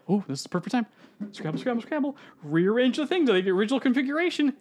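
high, hurried scheming; scheming villainess. Retold or imitated. Villain seizing the chaos to finish the ritual, hurried and high. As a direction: rush to rearrange the ritual. Ilsa scheming mid fight.

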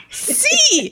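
high Cockney echo; mock Cockney. Echoing co-host's impression of the woman, mock Cockney. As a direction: parrot it in exaggerated Cockney. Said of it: "doing a Cockney accent".

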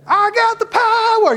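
high, loud hammed-up boast; mock faith healer. Pastor mocking charged-up prayer with a high, loud boast. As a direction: puff up like a TV healer.

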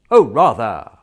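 loud, emphatic burst; eager agreement. Acted. Relieved prisoner accepting his fine, loud and eager.

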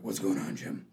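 a light imitation of a gruff, low Batman. Batman greeting Jim Gordon at the perimeter, lightly mock gruff.

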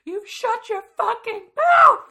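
screaming shut your mouth in rage; furious shout. Retold or imitated. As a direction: explode at them, full volume. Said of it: "she just totally lost it".

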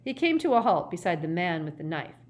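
ordinary delivery, nothing marked

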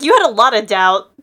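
mock emphatic Lyra. Co-host voicing Lyra scolding Yorick, loud mock jab. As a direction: call him out loud. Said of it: "she's like".

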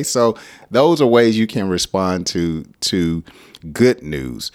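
usual speaking voice

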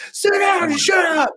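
shrill mock parental scream; yelling parent. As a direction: bark the order at the kid like a fed-up parent. Quoting parents yelling at child.

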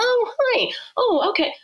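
high-pitched, taken-aback greeting; mock surprised politeness; mildly shocked. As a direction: imitate someone caught off guard, voice jumping up. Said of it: "they're a little bit shocked by it".

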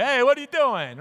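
brusque New York "what are you doing". Shown as a demonstration. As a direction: snap it out like a New Yorker. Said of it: "And not like that. That's in New York".

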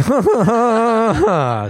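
high, loud imitation laugh; mock audience laugh. Comic imitating people with glasses, mock laughter. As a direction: put on a bespectacled nerd's loud laugh. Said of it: "a lot of people with these glasses would be like".

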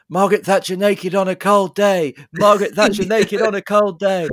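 high, loud, frantic mantra; hammed-up desperate chanting; Austin Powers. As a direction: chant it loudly to fend off arousal. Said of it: "he has the mantra".

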